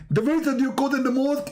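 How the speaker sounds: high, fast scolding preacher impression; Zakir Naik as Jibreel. Angel accuses Muhammad over the verse, high hammed-up preacher. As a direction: rattle off the accusation, high and insistent. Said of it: "You look like Zakir Naik".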